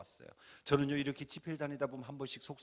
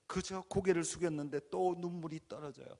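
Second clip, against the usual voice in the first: ordinary talk, nothing marked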